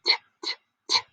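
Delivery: crisp "t" with no vowel after it; isolated t sound. Shown as a demonstration. Teacher shows the beginning sound, "t" isolated. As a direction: sound out "t" alone, a short tap of the tongue. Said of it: "Do you hear that t sound?".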